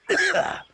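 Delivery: harsh, high hacking cough. Acted. Old sailor fishing for pity, hammed-up cough. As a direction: cough it up like a worn-out old salt. Sailor fakes sickness for money.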